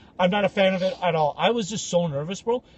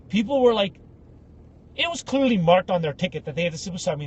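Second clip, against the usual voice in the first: usual speaking voice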